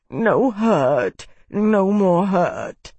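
sobbing. A narrator's reading.

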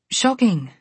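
ordinary delivery, nothing marked

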